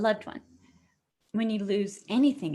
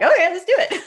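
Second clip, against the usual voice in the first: louder and much higher, laughing as she says it; excited yes; 9 semitones higher, 10 dB louder, faster than usual. Retold or imitated. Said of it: "I'm like".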